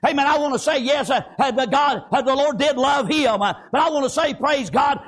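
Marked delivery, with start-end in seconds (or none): none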